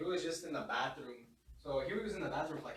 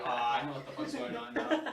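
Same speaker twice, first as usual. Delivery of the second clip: amused realization; 6 dB louder than usual. Retold or imitated. Realizing what's happening in the bathroom.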